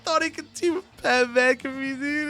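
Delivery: high, squealing words through put-on laughter; hysterical laughing. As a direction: act laughing too hard to speak. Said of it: "he keeps laughing hysterically".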